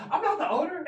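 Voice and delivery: high, laughing protest; laughing denial. Retold or imitated. High laughing denial to a fan who thinks he owns the club. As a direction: squeal it out through laughter. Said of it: "And I go".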